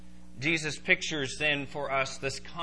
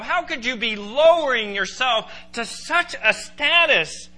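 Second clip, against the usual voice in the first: loud, high-pitched outraged disbelief; incredulous Peter; 11 semitones higher, 11 dB louder, slower than usual. Acted. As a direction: hammer it out slowly, aghast. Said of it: "Peter was incredulous".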